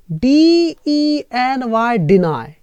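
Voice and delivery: slow, deliberate spelling of डीईएनवाई. Shown as a demonstration. Teacher slowly spelling a verb for students. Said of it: "डीईएनवाई को हटा के IED लगा दो".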